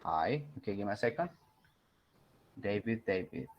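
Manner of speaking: usual speaking voice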